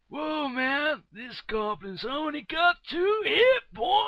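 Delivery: high, loud astonished exclamation; amazed character voice. Acted. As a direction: gasp it out in shock.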